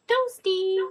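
very high, squeaky game announcer; Toasty announcer voice. Retold or imitated. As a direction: pop in with a squeaky high cry. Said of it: "and just say".

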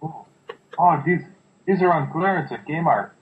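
a lightly put-on Mexican accent. Voicing Van Damme's character in a mock Mexican accent. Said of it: "I don't know why I made him sound Mexican".

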